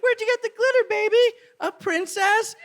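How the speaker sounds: put-on high, sweet baby-talk voice; cooing at a child. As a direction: coo over her like a little princess. Parent cooing at daughter.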